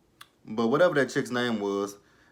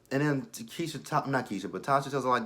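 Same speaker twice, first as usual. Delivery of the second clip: usual speaking voice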